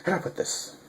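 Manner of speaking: soft whispered aside. Real, not acted. Vlogger pausing before big news, whispered. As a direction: whisper it to yourself, hunting for words.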